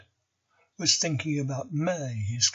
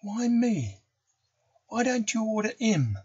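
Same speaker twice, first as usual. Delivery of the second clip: high indignant schoolboy whine; whiny protest; 8 semitones higher than usual. A narrator's reading. Nobby protests being ordered.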